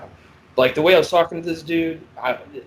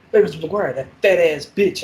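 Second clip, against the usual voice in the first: taunting inmate; 4 semitones higher, 5 dB louder than usual. Retold or imitated.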